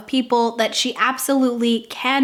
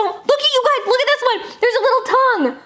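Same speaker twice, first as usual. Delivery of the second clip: much higher, laughing delight; giddy squeal; 12 semitones higher than usual. Real, not acted. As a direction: shriek and giggle at the cute tongue. Laughing at the tongue mask.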